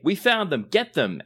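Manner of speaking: loud, high triumphant guard yell; a guard spotting the prey; guard shouting. Acted. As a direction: bark it like a guard closing in. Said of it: "you hear a guard say".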